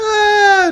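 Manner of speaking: long, high, loud laughing fit ending in a sigh; real belly laugh running high; helpless laughter. Real, not acted. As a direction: crack up completely, then let out a loud "ah". Laughs hard at verdict on accent.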